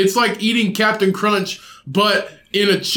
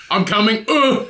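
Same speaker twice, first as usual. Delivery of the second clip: Ryan's grunt; 4 semitones higher than usual. Retold or imitated. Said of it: "Ryan's audible sound".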